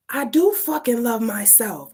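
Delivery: emphatic retort short of a scream; defiant cry. Retold or imitated. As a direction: push back hard, voice climbing. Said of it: "I'm screaming".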